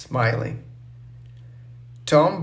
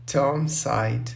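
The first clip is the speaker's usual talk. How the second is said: usual speaking voice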